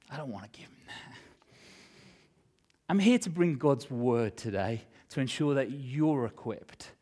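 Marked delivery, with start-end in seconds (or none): 0.1-1.2 hushed, fast whisper. Real, not acted. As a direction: say it under your breath, defiant. Preacher refusing the enemy.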